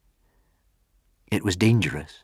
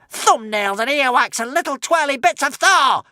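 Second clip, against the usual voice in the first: cursing witch. Acted. The witch cursing her luck, high and crazed. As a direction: spit the curse like a mad witch. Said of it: "cursed the witch".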